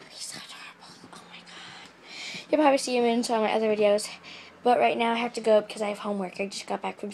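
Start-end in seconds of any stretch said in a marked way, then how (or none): 0.1-2.5 whisper. Real, not acted. Whispered gushing.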